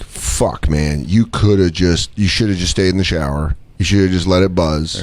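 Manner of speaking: low, deflated self-talk; regretful self-reproach. Retold or imitated. Man back in shower regretting outburst, low deflated reproach. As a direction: scold yourself, coming down from anger.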